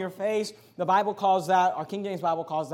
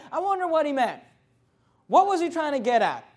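1 confused listener; 6 semitones higher than usual. Retold or imitated. Confused churchgoer voiced.

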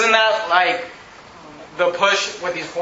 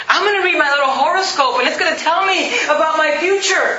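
mock horoscope fan; 6 semitones higher than usual. Mocking horoscope reader.

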